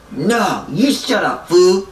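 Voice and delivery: high retort, loud on the last word; mock angry comeback. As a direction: imitate a quarrelling buddy, punching 'fool'. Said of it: "And he's like".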